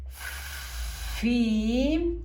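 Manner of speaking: "Fi" with a very drawn-out hissing f; long hissed f. Shown as a demonstration. Teacher points out another syllable, "Fi" with long hissed f. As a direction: stretch the f of "Fi" like an angry cat. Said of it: "La f del gato enojado".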